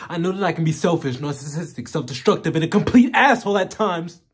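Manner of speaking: building to a loud, high outburst; loud self-reproach. Acted. Confessing to Diane he can be selfish, self-loathing outburst. Monologue character lists his faults.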